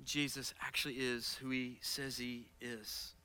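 soft, hushed inner voice; inner whisper. Retold or imitated. A listener's heart answering, soft and hushed. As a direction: let it be a quiet thought inside. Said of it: "kinda whisper to your heart".

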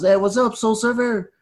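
high, bright-pitched dude call with a rising end; friendly surfer dude greeting. Retold or imitated. Surfer paddles over and greets the newcomer, high rising hail. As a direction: hail him like a laid-back surfer buddy.

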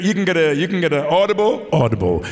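loud, high hard-sell plug; salesman pitch. Pretend. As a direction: hawk the book like a market trader. Jokey book plug to audience.

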